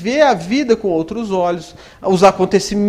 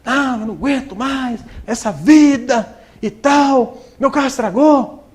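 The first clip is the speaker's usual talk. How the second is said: mild mock outrage, slow and higher; a hint of a fed-up friend ranting; revolted complaint; 5 semitones higher, slower than usual. Friend with a broken car complains, mildly outraged. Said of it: "chegou perto de mim revoltado".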